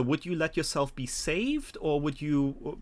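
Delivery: normal talking voice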